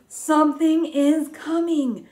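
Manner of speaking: high, slow, hushed-to-loud storybook suspense; gasping excited read-aloud; dramatic suspense. Shown as a demonstration. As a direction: build the suspense for the kids. Teacher reads suspenseful line to kids.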